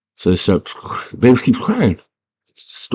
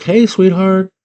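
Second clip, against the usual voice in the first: high-pitched, coaxing dad impression; raised singsong mock-dad voice; 12 semitones higher, 3 dB louder than usual. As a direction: imitate a dad calling sweetly through the door, high. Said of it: "her dad's like".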